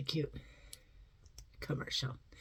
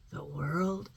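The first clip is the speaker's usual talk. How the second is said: low hushed announcer whisper; mock ad voiceover, hushed; commercial tagline whisper; 9 semitones lower, 8 dB quieter than usual. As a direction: deliver the slogan in a whisper. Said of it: "That's a commercial".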